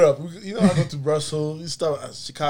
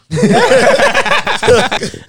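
big high burst of laughter; loud high laugh; 15 dB louder than usual. Real, not acted. Laughing hard with friends at the visa memory. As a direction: crack up loudly. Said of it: "You're just laughing".

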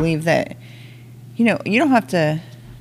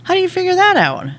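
indignant question. Acted. Dwarf reacts in the joke.

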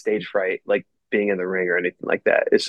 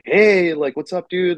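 higher-pitched, casual hey-there; retold friendly greeting; 7 semitones higher than usual. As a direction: imitate a buddy's upbeat hello.

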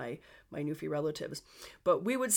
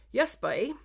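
amateur Newfoundland accent, raised pitch; Newfie accent; 5 semitones higher, 4 dB louder than usual. Shown as a demonstration. Podcaster demonstrating local phrases for listeners, attempted Newfie accent. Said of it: "trying to do a Newfie accent".

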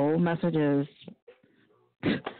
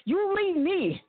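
mock dramatic plea; 11 semitones higher than usual. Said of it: "you just gotta be like".

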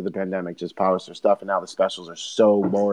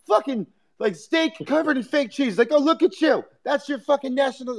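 mock rant. Comic mocking Philly cheesesteaks, high mock rant. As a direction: drag out the insult. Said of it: "He's like".

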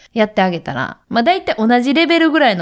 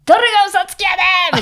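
mother snapping at her son over a game; snapping back; 12 semitones higher, much faster than usual. Retold or imitated. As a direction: lose it at the kid, half in fun. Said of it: "プチンや".

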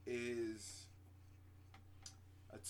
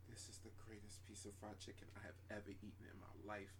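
awed; 7 semitones lower, 11 dB quieter than usual. Retold or imitated. Savoring fried chicken.

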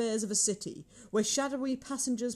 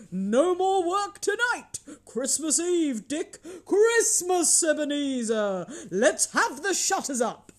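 high, hearty, drawn-out exclamations; loud festive boss rallying his lads; jovial announcement; 6 semitones higher, 4 dB louder than usual. A narrator's reading. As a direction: announce the holiday with gusto. Fezziwig announces the Christmas holiday.

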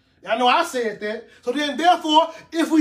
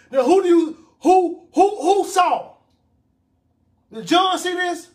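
loud, higher, pressing who; emphatic question; 6 semitones higher, 4 dB louder than usual. Real, not acted. Preacher quizzing the congregation, loud and high. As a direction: demand the answer from the room.

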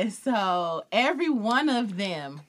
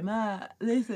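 swollen tongue; 4 dB quieter than usual. Retold or imitated. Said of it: "I'm trying to talk like my tongue is swollen".

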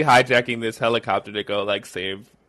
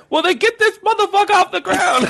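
much higher, louder mock shout; hammed-up yelled order; 6 dB louder than usual. Brother ordering the helicopter off the ground, mock yell. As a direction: holler an impatient command in imitation.

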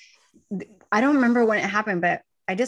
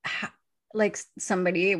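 usual speaking voice

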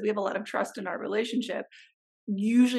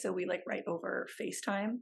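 usual speaking voice

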